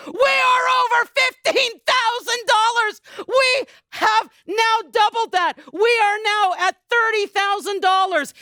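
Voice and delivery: high, loud, thrilled announcement; host yelling the doubled total in triumph; excited shout. Real, not acted. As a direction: shout the news to the crowd.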